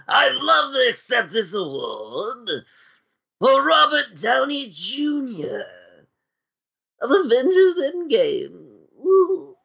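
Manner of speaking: lightly voiced Joker, a bit high; hammy Joker acceptance speech. Joker voice continues.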